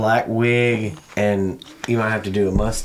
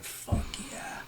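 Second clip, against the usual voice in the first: whispered awe; 15 dB quieter than usual. Retold or imitated. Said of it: "I also was like".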